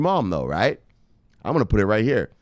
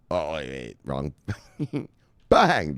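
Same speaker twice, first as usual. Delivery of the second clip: ordinary talk, nothing marked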